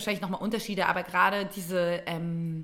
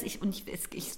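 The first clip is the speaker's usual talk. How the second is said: whisper; 11 dB quieter than usual. Retold or imitated. Whispering frustration in story.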